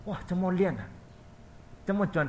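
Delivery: high, astonished gasp of wonder; a mother dazzled by the light; mother voiced amazed at the brightness. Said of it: "他的妈妈看到".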